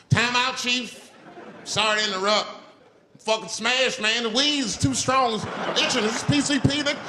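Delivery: freaking out that spirits have him; mock terror building to a high yell; stoned panic.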